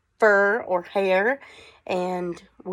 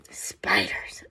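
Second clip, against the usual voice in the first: softly whispered answer, lower; whispered reveal; 13 semitones lower, 6 dB quieter than usual. Acted. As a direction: breathe the word out like a secret.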